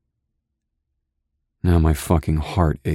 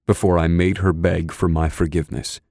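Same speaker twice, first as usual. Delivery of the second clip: usual speaking voice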